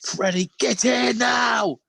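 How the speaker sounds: high, drawn-out angry-dad shout; stern summons. Retold or imitated. Dad calling kids for a secret treat, mock-angry shout. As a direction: holler for the kids as if they're in trouble. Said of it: "call 'em like they're in the shit".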